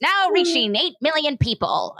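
a hint of grand newsreel announcing; sixties narrator. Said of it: "sixties vibe where it's like".

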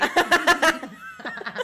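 bright burst of laughter; loud laugh. Real, not acted. Laughs at "another member" joke.

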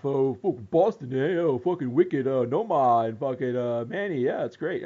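mocking Boston transplants in a put-on Boston accent. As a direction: ham up a Boston bro accent, swearing. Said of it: "your prick people who move over here".

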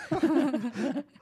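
rising high laugh; laughing at the episode name; high giggle. Real, not acted. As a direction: giggle upward. Laughs at mail.

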